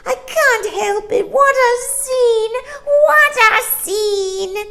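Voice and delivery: put-on high, loud wailing child's protest; crying child voice. A narrator's reading. Little boy crying out to his family, high and loud. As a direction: wail it like a desperate little boy. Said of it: "he cried".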